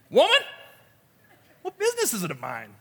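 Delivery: high, sharp cry on WOMAN; mock-indignant kid sassing his mother; kiddish backtalk. Shown as a demonstration. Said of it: "in this very kiddish way".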